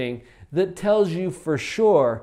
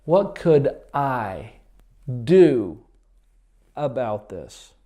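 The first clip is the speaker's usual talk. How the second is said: slow pondering question; 4 semitones lower, 6 dB louder, slower than usual. Shown as a demonstration. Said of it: "ask this question".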